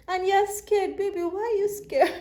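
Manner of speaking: high, emotional cooing to someone scared; tearful concern. Real, not acted. Tarot reader to a scared client, tearful concern. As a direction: pitch climbing as tears well up. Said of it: "I literally feel like crying right now".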